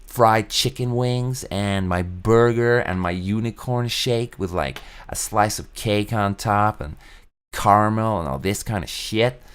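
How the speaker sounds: lightly voiced greedy American, slow on the food list; a hint of a greedy American listing food; mock American eater. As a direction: drawl out the menu like an indulgent glutton. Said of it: "it's like".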